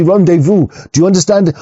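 usual speaking voice

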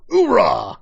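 mock Marine battle cry, laughing; loud gung-ho soldier. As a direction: bark it like a hammed-up grunt. Mocking Marines after a clip.

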